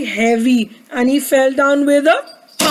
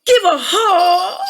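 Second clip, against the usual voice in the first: high, drawn-out plea; Douglas pleading; 4 semitones higher, slower than usual. A narrator's reading. Douglas wanted a hug so bad, high wailing plea. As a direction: wail it out, stretching HUG. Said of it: "He wanted a hug so bad".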